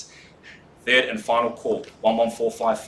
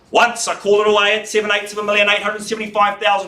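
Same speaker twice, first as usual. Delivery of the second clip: loud auctioneer call; 6 semitones higher, 4 dB louder than usual. Real, not acted. Auctioneer calling the bid.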